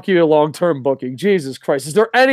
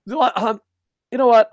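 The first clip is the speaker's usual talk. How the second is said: usual speaking voice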